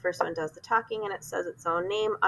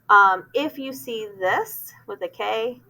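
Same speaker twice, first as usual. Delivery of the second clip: usual speaking voice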